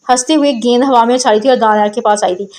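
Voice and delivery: plain reading voice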